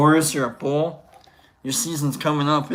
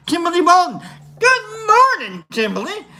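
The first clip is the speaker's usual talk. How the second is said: high-pitched greeting; 12 semitones higher, 5 dB louder than usual. Pretend. Streamer greeting Kimberly in chat, high and hammed-up. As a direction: sing out the greeting far above your usual pitch.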